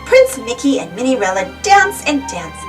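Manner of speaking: ordinary delivery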